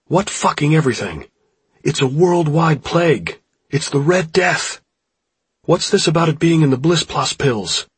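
high, loud, frantic outburst; furious, panicked shouting; yelled. A narrator's reading. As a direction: yell the alarm at a careless friend. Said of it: "Jimmy yelled".

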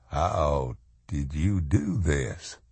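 slow, low, soft question; hushed awe. A narrator's reading. Josh asking Swan about the burned letters, hushed awe. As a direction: ask it softly and slowly, in awe.

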